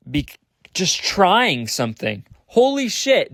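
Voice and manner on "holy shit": high-pitched holy shit; sudden realization. Real, not acted. Realizing just trying matters, a real high exclamation. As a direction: burst out in amazement. Struck by her own point.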